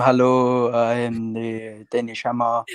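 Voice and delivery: slow, deliberate mock French-Danish accent; slow put-on French-Danish accent for a joke. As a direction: introduce yourself in a hammy fake Danish-French accent. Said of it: "best French Danish accent I've ever heard".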